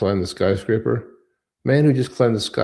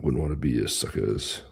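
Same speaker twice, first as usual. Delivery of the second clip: low taunt; 9 semitones lower, 6 dB quieter than usual. Pretend. Host taunting the viewers, lower and softer.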